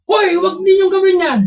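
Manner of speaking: angry shout. Retold or imitated. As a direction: bark it at the noisy kids.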